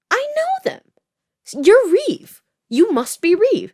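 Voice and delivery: high, loud eager stranger voice; sudden bright recognition; a hammed-up high voice for a boy spotting her; excited recognition.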